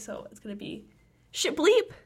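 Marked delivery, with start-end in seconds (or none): 1.3-1.9 high sung bleep over swear; censor bleep. Shown as a demonstration. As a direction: chirp the word bleep up high. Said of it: "voice over of me saying bleep".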